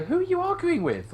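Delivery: a high, loud retort that sends up the question; mock exasperated ask. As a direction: fling it back at them in disbelief. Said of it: "and you kinda go".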